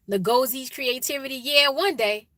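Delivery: ordinary talk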